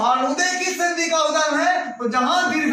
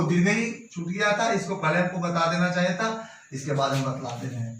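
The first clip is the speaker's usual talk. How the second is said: fast muttered aside; 7 semitones lower, 6 dB quieter, faster than usual. Real, not acted. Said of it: "quick self-correction aside".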